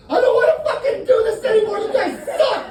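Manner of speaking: shrieks way up high and loud, losing it; a pilot snapping and screaming at passengers; high screaming meltdown. Pretend.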